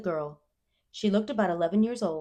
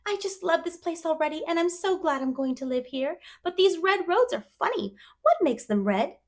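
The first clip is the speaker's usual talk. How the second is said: put-on higher, eager girl voice; dreamy chatterbox girl; 11 semitones higher, 6 dB louder than usual. A narrator's reading. Anne telling Matthew about dresses, wistfully eager.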